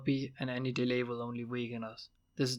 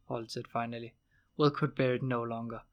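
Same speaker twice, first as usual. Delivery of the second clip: plain reading voice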